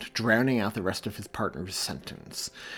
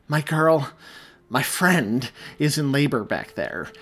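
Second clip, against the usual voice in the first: higher and louder frantic pleading; 4 semitones higher, 6 dB louder than usual. A narrator's reading. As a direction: rush it out, desperate for help. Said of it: "He motioned wildly".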